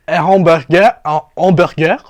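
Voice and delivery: high, loud questioning French worker; mocking McDonald's worker. McDonald's worker pushing a hamburger, loud mocking question. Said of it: "He's like".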